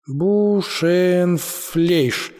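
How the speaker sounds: drawn-out, syllable by syllable; reading with difficulty. A narrator's reading. Мишка struggles to read a German label, very slow. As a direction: sound out a strange foreign word slowly. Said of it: "с трудом прочитал Мишка".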